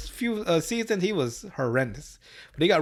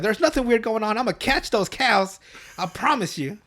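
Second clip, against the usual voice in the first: a high, breezy lightly voiced cop; overconfident cop; 7 semitones higher than usual. Cop promising the rancher he will catch the cows. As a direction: promise it like a blowhard. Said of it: "Officer Barber is like".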